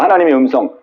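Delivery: ordinary talk, nothing marked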